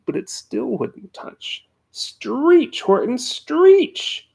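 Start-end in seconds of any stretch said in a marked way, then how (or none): 1.9-4.3 very high, very slow and louder; shrieking yell. A narrator's reading. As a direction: push him harder, stretching the words out. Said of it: "yelled the bug".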